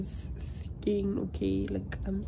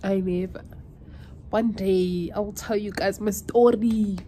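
usual speaking voice

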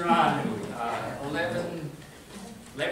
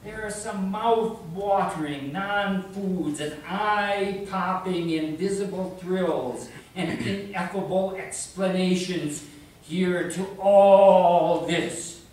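emphatic recital building to a loud, high peak; poetry recital; 4 semitones higher, 9 dB louder, slower than usual. Acted. Poet voicing a chiding disembodied voice, emphatic declaiming. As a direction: declaim it, punching the rhymes harder and harder.

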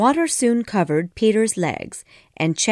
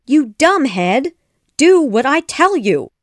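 high, loud, exasperated scolding; angry shout; 6 semitones higher, 5 dB louder than usual. A narrator's reading. Mother bird scolding Peter, angry shout. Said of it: "became very angry and shouted".

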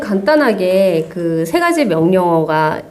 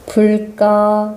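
very slow, deliberate command; talking to voice assistant; much slower than usual. Shown as a demonstration. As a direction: stretch each word out for the device. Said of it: "큰 목소리로 해주세요". (기기에 아주 천천히 또박또박 말하는 목소리)

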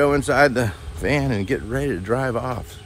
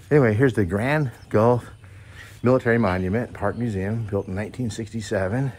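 usual speaking voice

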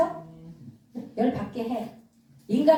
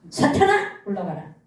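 commanding rebuke; 3 semitones lower than usual. Shown as a demonstration. Preacher shows believers how to banish Satan, commanding rebuke.

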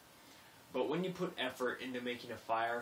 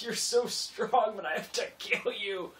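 high, loud, slow hammed-up lament; mock dramatic farewell; 9 semitones higher, 8 dB louder than usual. To the revived fire before drowning it, hammed-up high lament. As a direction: play it like a tragic hero killing a worthy foe.

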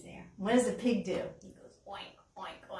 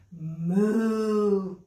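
cow moo; 4 semitones lower, 5 dB louder than usual. Pretend. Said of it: "And Cow goes".

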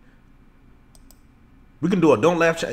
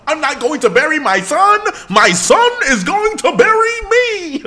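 mock-sob the father's lines much higher and louder; imitating John Q; 7 dB louder than usual. Retelling the skit's father scene to chat, in a hammed-up high wail. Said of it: "John Q had me screaming".

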